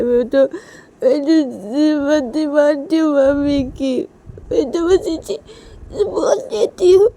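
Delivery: put-on high, loud sobbing child with stretched words; loud crying. Liya crying to papa that mummy has gone, loud wailing. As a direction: wail it out slowly, high and tearful. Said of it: "लिया बहुत ज़ोर से रोने लगती है".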